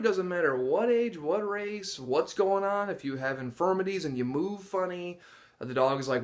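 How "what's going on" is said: usual speaking voice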